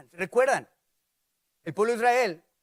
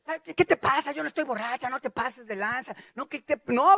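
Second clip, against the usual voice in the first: higher-pitched mock indignation; indignant protest; 4 semitones higher than usual. Woman accused of drinking while praying, indignant protest.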